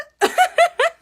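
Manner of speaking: high, loud burst of laughter; real loud giggle. Real, not acted. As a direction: let a big laugh burst out. Laughing at herself.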